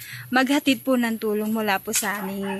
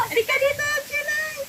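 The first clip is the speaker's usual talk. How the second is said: calling out. Real, not acted. Calling the sister over.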